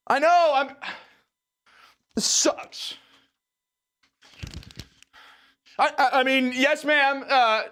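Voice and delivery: high, loud, whiny protest; whiny teen arguing. Acted. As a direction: argue back at mom like a sulky teen. Teen arguing with mom on phone.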